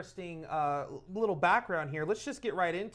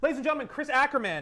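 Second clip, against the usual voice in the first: quick, high, louder host introduction; live guest announcement; 6 semitones higher, 3 dB louder, faster than usual. Real, not acted. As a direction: bring the guest on with a fast, lifted announcement.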